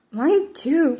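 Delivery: usual speaking voice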